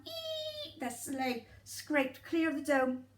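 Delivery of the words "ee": high thin screech. Acted. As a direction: imitate a screeching scrape. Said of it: "with a screeching sound".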